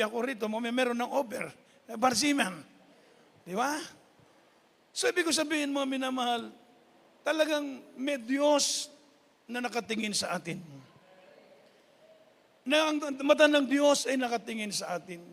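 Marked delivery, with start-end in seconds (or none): 4.9-15.2 raised, louder preacher's voice; preaching at full force. Acted. Preacher telling the congregation their life is with God, in full force. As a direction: proclaim it to the congregation.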